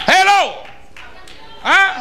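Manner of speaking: Hello as a sharp, sarcastic jab; preacher needling the gossip listeners, sarcastic. Acted. Preacher mocks gossip lovers.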